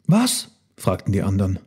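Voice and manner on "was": high rising question; eager question. A narrator's reading. Others prompt hesitant Elmar, high eager question. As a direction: jump in with a high query.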